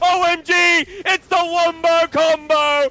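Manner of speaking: loud, high, thrilled play-by-play call; excited shouting. Real, not acted. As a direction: yell the big teamfight as it unfolds. Teamfight climax in live cast.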